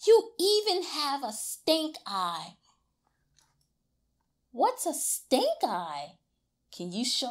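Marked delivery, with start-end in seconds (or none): 0.1-2.6 higher praising big brother; higher, cheerful praise. A narrator's reading. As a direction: cheer him on.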